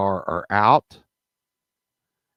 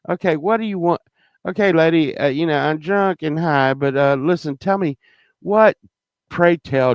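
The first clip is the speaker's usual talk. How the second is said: higher, fed-up questioning; mock-polite exasperated pray tell; 7 semitones higher than usual. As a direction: demand an answer, drunk and annoyed. Drunk narrator confronts the girl.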